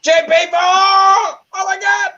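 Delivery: high, loud excited yell; excited shout. Real, not acted. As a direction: shout it out in delight. Excited outburst reading chat.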